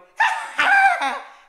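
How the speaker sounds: very high, loud maniacal laugh; manic shrieking cackle. Retold or imitated. Babysitter cackling at kids in the shower. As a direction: cackle like a movie killer.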